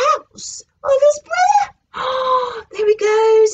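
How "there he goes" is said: ordinary delivery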